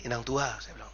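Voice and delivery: low, soft, deadpan question; hushed, pointed comeback; sly quiet retort. Retold or imitated. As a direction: put it to the mother softly and low. His sly retort to the mother.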